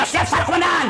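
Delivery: laughing mock military bark; comic shouted salute to the commander; mock soldier shout. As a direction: yell it like a scolded recruit, half laughing. Policeman kicked, answering commander.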